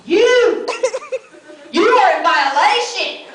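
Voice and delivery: loud, slow barked command; mock drill sergeant. Sleepwalking husband barking range orders at his wife. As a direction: bark it like a range officer.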